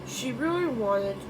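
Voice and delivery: ordinary delivery, nothing marked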